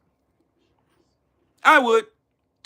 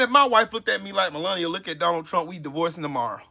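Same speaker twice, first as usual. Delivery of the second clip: ordinary talk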